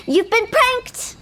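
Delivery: gleeful reveal. Acted. Director telling the client it was a prank, slow gloat. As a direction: savour each word of the reveal.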